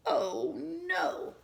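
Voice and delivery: high, loud oh no; mock alarm. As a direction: cry it out in pretend alarm. Power outage in story.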